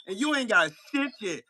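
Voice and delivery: ordinary talk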